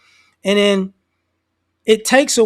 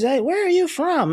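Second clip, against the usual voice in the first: mock old lady; 8 semitones higher than usual.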